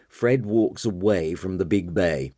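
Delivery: usual speaking voice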